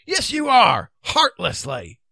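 loud, indignant shout; angry retort. Acted. Knight insisting the king deserts them, angry. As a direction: fire back the accusation.

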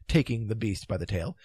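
ordinary delivery

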